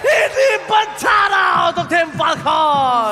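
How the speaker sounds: excited shouting. Real, not acted. Caster yelling over a big play.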